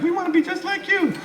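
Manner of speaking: put-on very high child's voice; kid voice. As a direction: chirp like an eager little kid. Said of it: "little kids like".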